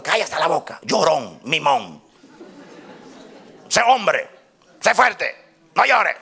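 mock telling-off, building to high, loud commands; acted scolding. Preacher mimicking someone unsympathetic to a crying friend, scolding.

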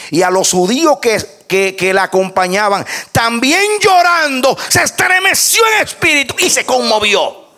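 high, mounting preacher's cry; full-force pulpit reading; impassioned preaching. Real, not acted.

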